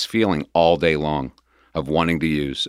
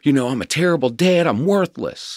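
raised, pitched-up tearful protest; upset defiance; 9 semitones higher than usual. Retold or imitated. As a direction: push back at the guard, voice high and strained. Retold defiance to security guard.

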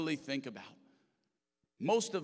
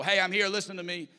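loud, higher attention-grabbing shout; mock yell; 6 semitones higher, 10 dB louder than usual. Pastor showing how God doesn't speak, yelling. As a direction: yell it out to butt in. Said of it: "God doesn't yell".

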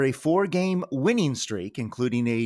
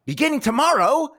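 dramatic reveal; 12 semitones higher, 4 dB louder than usual. Acted. Dramatic announcement of Mets series.

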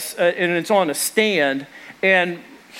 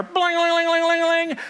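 high, sung-out guitar strings jangling; imitated guitar twang; 10 semitones higher than usual. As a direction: mimic the strings ringing as he lands. Grandpa falls onto guitar.

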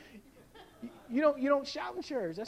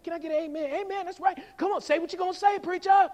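high, building amen-corner calls; congregation talking back; 7 semitones higher, 6 dB louder, faster than usual. Acted. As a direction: cheer the preacher on, getting louder. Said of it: "people are talking back".

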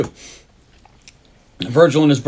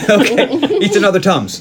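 amused, higher-pitched chuckle through the words; laughing; 7 semitones higher, 4 dB louder, slower than usual. Real, not acted. Podcaster cracking up while retelling a heist scene, laughing.

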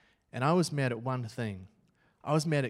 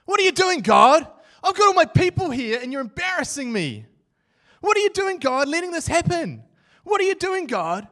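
much higher and louder, yelling at the sky; furious shout at God; 13 semitones higher, 10 dB louder than usual. Retold or imitated. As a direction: scream your frustration upward, on your knees. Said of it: "I'm really annoyed at God".

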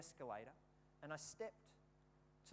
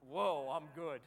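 cocky whoa; 3 semitones lower, 8 dB louder, slower than usual. Retold or imitated. Boastful after hitting the boy.

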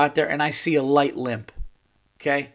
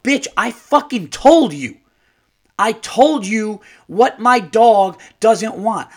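furious inner rant; 6 semitones higher, 5 dB louder than usual. Retold or imitated. Furious owner silently ranting at careless shelter worker. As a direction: chew her out in your head.

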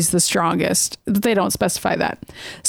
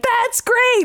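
retold scream; 12 semitones higher than usual. Cody answers Rogue's yes to running away, in a mock scream. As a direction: squeal it high as a mimicked outburst. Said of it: "he's, like, screaming it in her face".

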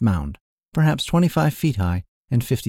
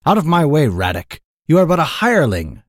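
loud, higher ranger's outcry; crying out; 5 semitones higher, 6 dB louder than usual. A narrator's reading. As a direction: cry it out as a proud, affronted ranger. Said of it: "cried the ranger".

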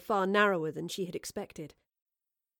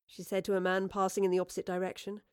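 ordinary delivery, nothing marked

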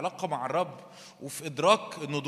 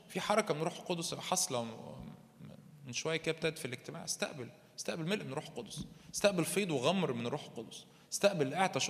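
soft prayer ministry; 5 dB quieter than usual. Acted. Soft ministry call to receive.

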